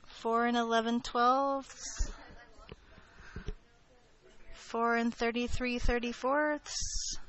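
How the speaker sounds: slow, drawn-out fractions to buy time; milk every fraction before reaching five; teasing stretched countdown. Pretend. Teacher teasing her class with a stalling slow countdown.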